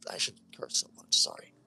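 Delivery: soft, whispered apology to listeners; quiet real aside. Real, not acted. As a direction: drop out of the story to apologize.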